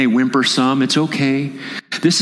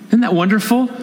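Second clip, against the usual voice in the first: ordinary talk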